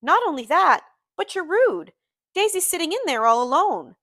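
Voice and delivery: high, loud, exasperated scolding; impatient. A narrator's reading. Friend scolding Gatsby for leaving Daisy, impatiently. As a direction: tick him off impatiently. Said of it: "I broke out impatiently".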